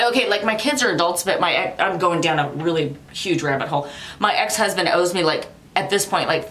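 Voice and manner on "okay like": normal talking voice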